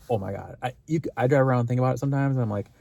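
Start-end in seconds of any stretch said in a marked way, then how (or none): none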